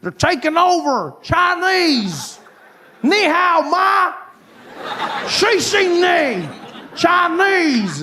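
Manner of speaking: high hammed-up alarmist rant; shrill-free high panicky mock warning. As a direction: squeal it like a panicked pundit. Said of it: "I see people say".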